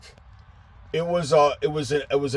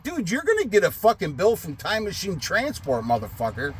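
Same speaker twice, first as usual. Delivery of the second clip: 1 mock angry retort; 7 semitones higher, 4 dB quieter than usual. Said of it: "I'm like".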